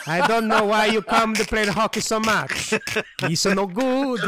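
hammed-up caricature of Tyrone scolding; Tyrone impression. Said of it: "Now do Tyrone".

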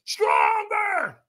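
very high full-throated yell; trainer's piercing demand, imitated; retold scream. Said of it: "So he screamed at me, got in my face and screamed at me".

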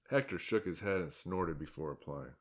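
plain reading voice

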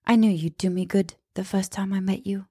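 imitating a strange put-on accent; lightly mimicked vague British accent; hammed-up unplaceable accent; mock odd accent. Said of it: "I don't know what that accent was".

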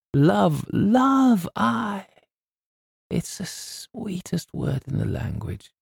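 dreamy Scots gush that fades to a hush; sentimental Scot. Acted. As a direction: gush over love like a softened agent. McKinnon turns sentimental about love.

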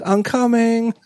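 high mock kid voice calling back; kid answering. Son answering his mom's yell from upstairs, high mock call. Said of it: "And Jonas goes".

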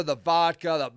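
usual speaking voice

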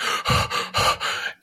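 two breathy gasps, catching air; mimed crying gasps; imitated gasps for breath. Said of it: "catch my breath, like".

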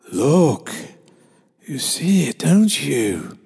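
a disembodied voice, half whispered in the middle; eerie drawl. A narrator's reading. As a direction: draw it out like an unseen voice in the dark. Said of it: "the same voice drawled".

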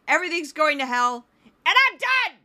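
loud, high mock screaming rant; over-the-top outraged yell, exploding at the end; Mark Levine. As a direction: scream it like an enraged pundit. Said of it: "That's my Mark Levine".

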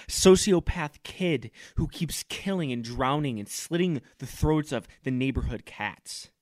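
ordinary talk

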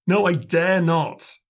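high, slow, aching refusal; stifled craving. A narrator's reading. Starving castaway refusing offered food, stifled craving. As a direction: refuse what you desperately want. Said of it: "a note of stifled craving".

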